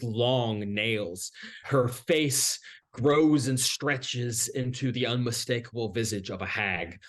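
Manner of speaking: ordinary talk, nothing marked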